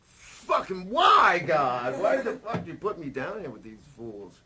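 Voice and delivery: yelled up at the sky, slow on the first words; anguished shout to God. Retold or imitated. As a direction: demand an answer from God at full volume. Retold cry to God.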